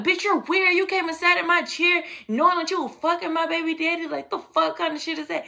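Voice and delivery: mock furious jab pitched high; baby mama snapping at the friend, high mock anger; imitating an angry rant. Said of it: "She talking shit".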